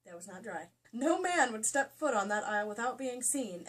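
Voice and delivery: usual speaking voice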